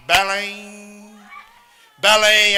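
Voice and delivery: drawn-out bell toll, belém belém; church bell imitation. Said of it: "começou o sino da igreja a bater". (imitando o sino da igreja, belém belém)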